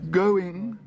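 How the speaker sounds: high, loud desperate call. Acted. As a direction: plead with the room. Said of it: "said the auctioneer desperately".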